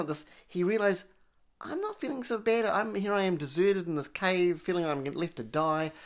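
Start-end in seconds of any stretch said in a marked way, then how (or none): 1.6-5.9 high, lightly voiced hermit, amazed; a revived castaway, marvelling; surprised hermit. Hermit's retold thought in cave.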